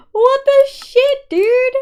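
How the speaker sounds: high, loud, slow yelp; outraged yelp. Real, not acted. Streamer squealing to a friend about burning chests.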